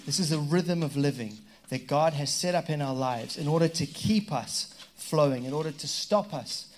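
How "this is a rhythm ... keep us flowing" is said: normal talking voice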